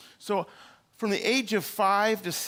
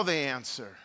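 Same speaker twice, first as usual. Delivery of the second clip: ordinary delivery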